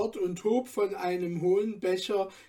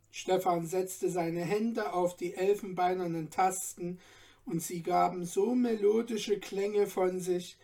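ordinary delivery, nothing marked

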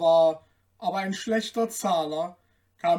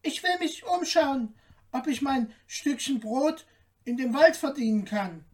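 small, high tailor voice; high-pitched, timid little man; piping tailor; 7 semitones higher than usual. A narrator's reading. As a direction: answer meekly in a high little voice. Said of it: "Der Schneider wisperte".

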